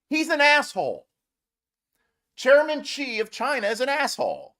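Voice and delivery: indignant. Real, not acted. Vlogger angrily rebutting Trump's praise of Xi. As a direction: spit out asshole with contempt.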